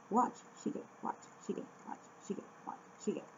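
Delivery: unvoiced whisper, repeating the word over and over; whispered drill. Real, not acted. Learner drilling Korean vocab, whispering it to herself.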